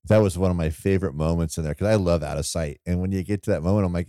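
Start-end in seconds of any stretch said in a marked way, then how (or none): none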